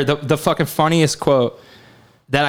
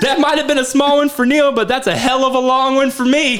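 gleeful whoop; 10 semitones higher than usual. Retold or imitated. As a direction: whoop it out like a giddy astronaut.

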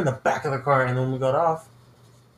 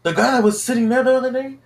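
high, louder incredulous question; retold disbelief; 10 semitones higher, 5 dB louder than usual. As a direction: imitate a guy who can't believe it. Retold guy's disbelief at learning identity.

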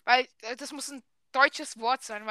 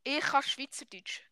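softer Swiss German repeat for a learner; 2 semitones higher, 5 dB quieter than usual. Shown as a demonstration. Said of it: "Das heisst".

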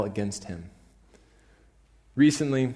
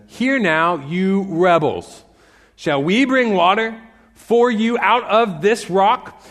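loud, slow, higher scolding; angry Moses; 7 semitones higher, 8 dB louder, slower than usual. Retold or imitated. Moses rebuking the grumbling Israelites, angry and slow. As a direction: rebuke the crowd from on high. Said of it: "Moses said to them".

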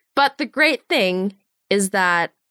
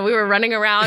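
ordinary talk, nothing marked

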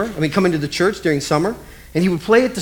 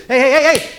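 calling out; 6 semitones higher, 5 dB louder than usual. Retold or imitated. Adult calling running kids.

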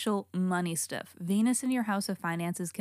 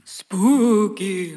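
spooky; 6 dB louder than usual. Pretend. Spooky aside after witchy talk.

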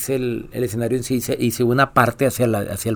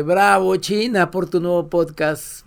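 mock cheer; 6 semitones higher, 4 dB louder, slower than usual. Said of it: "eso le grito siempre".

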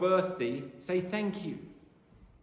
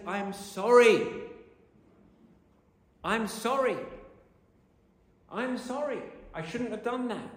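mean every word of it, higher and pleading; sincere apology; 7 semitones higher than usual. Shown as a demonstration. Preacher showing the congregation a real apology, sincere. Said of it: "say it like you mean it".

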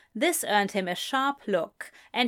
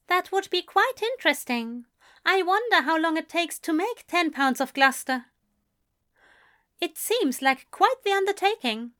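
higher, quick, curious gentleman; Aziraphale's voice; 8 semitones higher than usual. A narrator's reading. Aziraphale pressing Crowley about the cheese, higher and quicker. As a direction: pitch it up and press on eagerly.

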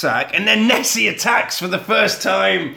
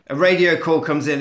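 normal talking voice

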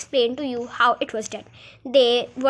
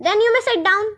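high loud royal reply; reply grandly; king voice; 8 semitones higher, 6 dB louder than usual. Acted. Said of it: "the king replied".

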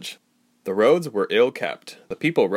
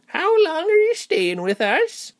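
very high, slow little-lady voice; high-pitched, prying old hostess; little old innkeeper; 4 dB louder, slower than usual. A narrator's reading. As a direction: inquire as a frail landlady, pitched way up. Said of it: "A frail little lady".

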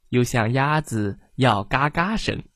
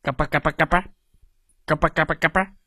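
imitated grown turkey gobbling; turkey gobble; 2 semitones higher, much faster than usual. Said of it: "他的叽叽声就变成了". (模仿大火鸡的嘎巴叫声)

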